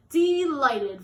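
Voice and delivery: loud, cheerful delighted; delighted reply. Acted. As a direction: beam it out. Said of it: "replied Mister Stickleback".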